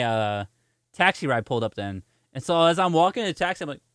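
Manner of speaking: ordinary delivery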